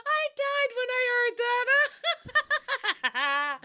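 high, giggly; laughing. Real, not acted. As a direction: talk through the giggles. Still laughing.

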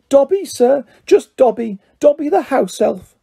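high, humble little creature; Dobby, house elf. A narrator's reading. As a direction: introduce yourself in a high elf voice. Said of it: "said the creature".

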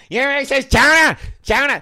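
loud, high Boston shout; Boston accent. Acted. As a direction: yell "chowder" in a Boston accent. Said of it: "can I correct you".

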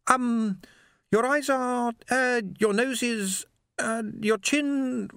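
high, slow, halting search for words; groping for words after dying; Swing, dazed and faltering. A narrator's reading. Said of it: "he gave up".

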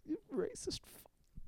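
high, slow bleeped-out insult; censored mob rant. Retold or imitated. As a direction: sputter it like an edited-for-TV gangster. Quoting same line again.

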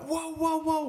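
high-pitched imitated alarm; startled whoa. Old driver seeing the body, imitated startled alarm. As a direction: jump up in pitch on quick whoas.

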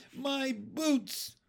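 put-on high-pitched drawn child's wail. A narrator's reading. Girl lifted out of her boots, wailing. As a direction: wail in dismay over a loss. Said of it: "she wailed".

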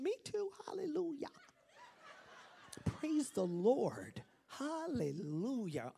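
high, laughing mock praise; mock gushing churchgoer. Preacher mocking fake friendly Christians, sing-song gushing. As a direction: ham up a syrupy believer.

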